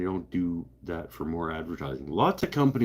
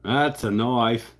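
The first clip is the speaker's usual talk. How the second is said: louder, slow Australian Crocodile Dundee delivery; Aussie accent; 4 semitones higher, 6 dB louder, slower than usual. Retold or imitated. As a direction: punch it out in a mock Australian accent. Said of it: "I can't really do the Aussie accent".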